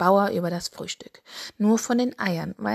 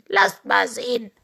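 higher rooster character voice; put-on rooster boss voice; 4 semitones higher, 4 dB louder than usual. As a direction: speak up as a cartoon rooster taking charge. Henry the rooster inspects the applicants.

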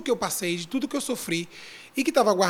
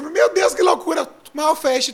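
excited; 7 semitones higher, 7 dB louder, slower than usual. Real, not acted. Recalling wild party reveal.

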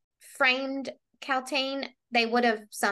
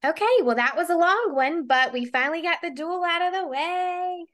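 relieved cheer; 6 semitones higher than usual. Real, not acted. Host wrapping up the long duel with her co-host, relieved cheer. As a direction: lift the pitch in happy relief and stretch the last word. Celebrates finishing a long segment.

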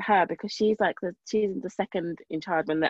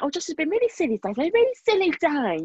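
high, sing-song teacher impression; mock the teacher's fussy report in a much higher voice; mimicking the teacher; 8 semitones higher than usual. Mother imitating the teacher who called Josh silly, high mocking sing-song. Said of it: "one teacher said".

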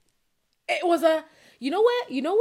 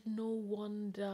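hushed aside; 4 semitones lower, 13 dB quieter, slower than usual. Retold or imitated. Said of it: "And I said".

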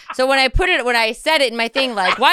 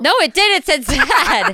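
laughing; 7 semitones higher, 4 dB louder than usual. Real, not acted. Laughing insistence.

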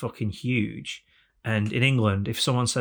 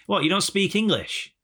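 higher-pitched mock indignation; arrogant English tourist; 5 semitones higher than usual. English holidaymaker to a local in Italy, mock-indignant. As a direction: lecture a foreigner with haughty disbelief. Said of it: "that is the English attitude".

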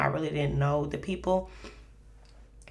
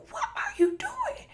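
very high-pitched, retold shock; alarmed disbelief. Dreamer to mom turning into light, alarmed disbelief. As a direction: cry out in mock disbelief, high up.

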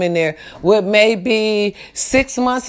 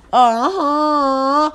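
crying uh-huh; 5 semitones higher than usual. Real, not acted. Emotional affirmation.

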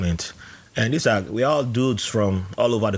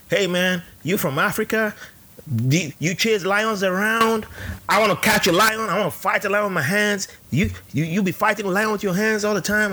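high, eager mock recruit; mock naive recruit; 10 semitones higher than usual. Mimicking a boot camp kid asking about lions, high and eager. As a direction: play the clueless kid who wants to fight lions. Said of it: "other kids who are like".

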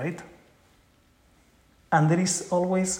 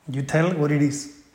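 ordinary delivery

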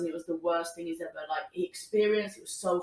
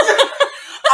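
shrieking giggle; high squealing laugh; 12 dB louder than usual. Real, not acted. Laughing at own story.

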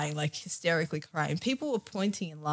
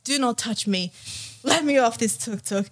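raised, higher mock yell at the driver; retold scream; 6 semitones higher, 4 dB louder than usual. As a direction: push him off with a half-shouted order. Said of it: "And I, like, screaming".

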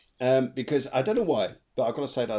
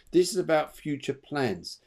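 ordinary talk, nothing marked

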